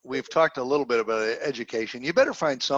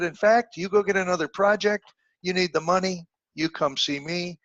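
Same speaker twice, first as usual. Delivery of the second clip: a mentor's voice lifted higher, gently firm; higher voiced mentor; 6 semitones higher than usual. Old mentor reassures a humiliated young man, higher voice. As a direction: play the old mentor talking sense into a kid.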